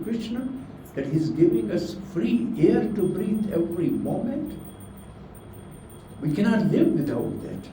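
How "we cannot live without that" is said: usual speaking voice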